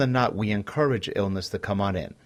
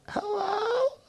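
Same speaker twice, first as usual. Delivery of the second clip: very high, slow, feeble sick-day voice; fake sick voice; much slower than usual. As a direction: fake being sick to your boss. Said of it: "the 'I feel sick' voice".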